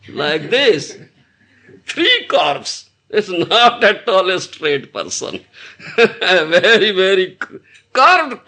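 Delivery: usual speaking voice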